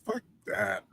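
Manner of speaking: breathy whispered fuck that; a kid hissing fuck that to grandma; whispered kid dismissal. Retold or imitated. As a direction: brush it off in a whisper. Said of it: "I was just like".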